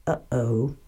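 soft, low aside; hushed uh-oh. A narrator's reading. Storyteller reacts to the bear's roar, hushed. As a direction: drop to a small, low uh-oh.

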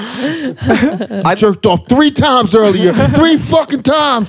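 high, slow and shouting; excited boast. Retold or imitated. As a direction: yell it like a proud boast.

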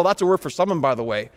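ordinary talk, nothing marked

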